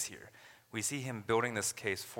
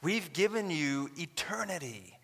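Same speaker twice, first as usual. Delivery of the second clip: voicing Paul; 6 semitones higher than usual. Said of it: "He's saying".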